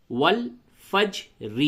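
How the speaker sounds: very slow, drawn-out والفجر; والفجر بہت کھینچ کر; slow recitation. Shown as a demonstration. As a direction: stretch والفجر out in recitation style. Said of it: "ہجے یوں ہوگا،".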